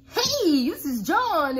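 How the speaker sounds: salesy voice. Shown as a demonstration. Caller greeting a prospect in an overexcited salesy voice. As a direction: sell it way too hard. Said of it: "that salesy voice".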